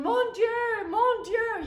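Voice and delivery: high, loud, panicked cries of mon Dieu; a grandmother wringing her hands in alarm; frantic French grandma. Retold or imitated. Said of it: "my grandmother is going".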